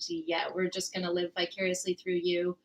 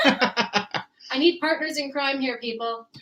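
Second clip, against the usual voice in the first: laughing; 5 semitones higher, 5 dB louder, slower than usual. Real, not acted. Pleading while laughing.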